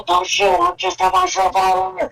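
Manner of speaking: panicked surrender shout. Acted. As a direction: yell it with hands up in the air. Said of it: "he's going to yell".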